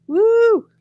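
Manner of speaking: high, loud cheer; excited whoop. Real, not acted. Cheering the two-woman ticket, an excited high whoop. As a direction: let out a happy woo.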